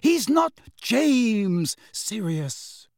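high, slow, emphatic reproach; heated rebuke. A narrator's reading. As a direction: drive each word home, pitched high. Quarrel, Molly rebukes Sirius.

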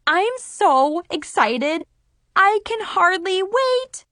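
bubbling over with excitement; excited. A narrator's reading. Party back on.

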